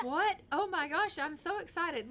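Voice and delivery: playfully acted burst of excitement; excited dad-to-be. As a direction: gush like you just heard baby news. Voices Sim hearing baby news.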